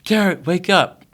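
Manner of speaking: calling to wake someone. Retold or imitated. Dad trying to wake son.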